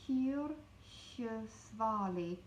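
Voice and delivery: Kyrkjesvali spelled out slowly, each syllable clear; slow careful. Shown as a demonstration. Teacher slowly sounding out a hard word. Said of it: "That is a hard word".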